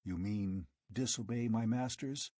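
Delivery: usual speaking voice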